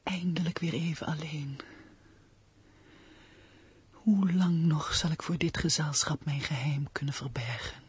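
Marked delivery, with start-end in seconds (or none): 3.9-7.8 intense stage whisper, mostly voiceless; anxious soliloquy. Acted. Woman finally alone, fearing the stranger at supper, anxious whisper. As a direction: breathe out the secret dread, with long pauses between thoughts. Alone, confesses secret dread.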